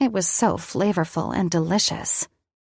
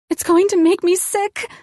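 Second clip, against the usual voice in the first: put-on high, whiny child's complaint; whiny five-year-old; 12 semitones higher, 4 dB louder than usual. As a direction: whine like a scared little kid. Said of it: "that stupid fucking whiny voice of a".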